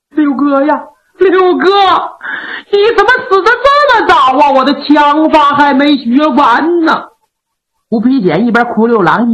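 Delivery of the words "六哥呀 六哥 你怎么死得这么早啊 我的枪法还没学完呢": high, fast wailing cry; loud wailing. Acted. Hu Pijian crying over his dead sixth brother, wailing. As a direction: wail out the loss, voice cracking. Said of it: "也大放悲声".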